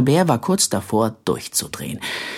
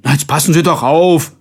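loud, raised complaint; indignant protest; 4 semitones higher, 6 dB louder than usual. A narrator's reading. Passengers bumped on the escalator, indignant protest. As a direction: snap in protest at the careless one. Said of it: "Sie protestierten".

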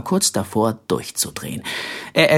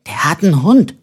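higher, louder, rising on Hund; more excited; 6 semitones higher, 5 dB louder than usual. A narrator's reading. Simpel pointing out a dog to his brother, excited. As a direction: blurt it out with growing excitement. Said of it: "wiederholte Simpel immer aufgeregter".

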